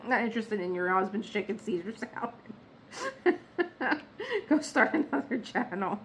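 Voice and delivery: laughing through the words, real giggles. Real, not acted. Telling viewers about YouTube, laughing helplessly. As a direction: crack up at your own joke while talking. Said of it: "I'm cracking up".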